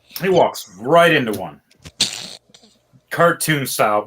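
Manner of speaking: normal talking voice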